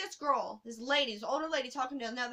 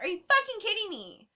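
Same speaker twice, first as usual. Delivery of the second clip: much higher, louder exasperated cry; outraged disbelief; 9 semitones higher, 5 dB louder than usual. Retold or imitated. To the bus worker refusing her tag, outraged. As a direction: blurt it in furious disbelief.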